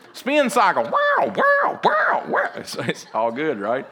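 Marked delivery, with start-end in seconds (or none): 0.8-2.5 very high repeated yowling; comic high-pitched cat cries; imitated cat yowls. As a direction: yowl like a cat tumbling round. Imitates cat in spin cycle.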